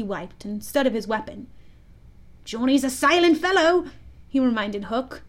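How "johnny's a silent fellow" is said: higher, louder character voice; Smee the pirate. A narrator's reading. Smee reminding his captain about his weapon, in a high pirate-sidekick voice. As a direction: pipe up as the loyal sidekick. Smee's voice in story.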